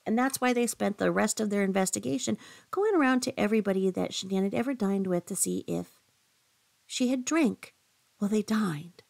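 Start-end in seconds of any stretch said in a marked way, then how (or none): none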